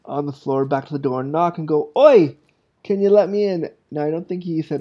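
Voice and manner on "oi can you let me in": high, loud mock-British call; British accent, hollered opening word; imitated British accent. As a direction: call out like an imagined British guy. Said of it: "in love with the British accent".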